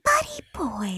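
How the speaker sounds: mock sleazy whisper; co-host copying the hillbilly ASMR whisper. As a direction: purr it like a creepy hillbilly.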